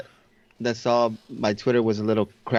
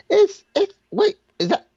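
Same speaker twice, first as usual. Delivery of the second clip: much higher, alarmed, stammering question; startled; 5 dB louder than usual. Retold or imitated. Friend noticing the quake starting, high startled stammer. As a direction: catch yourself mid-sentence, suddenly unsure. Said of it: "he's like".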